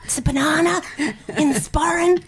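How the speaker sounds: high, breathy, half-laughing question; loud stage whisper. Retold or imitated. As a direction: blurt it out mid-show, too loud. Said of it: "and I said".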